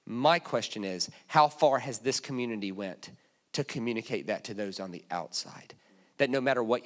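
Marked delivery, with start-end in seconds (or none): none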